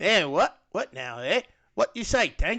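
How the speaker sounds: imitating a rough Australian accent; Captain Boomerang's accent. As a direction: put on a jokey Aussie accent. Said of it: "his natural accent".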